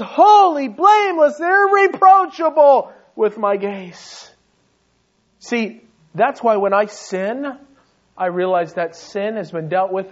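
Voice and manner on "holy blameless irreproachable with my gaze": loud, high ringing declaration of holy; God's proclamation. Acted. Preacher voicing God declaring believers holy, loud and ringing. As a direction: proclaim it as God, slowing at the end. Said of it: "He says".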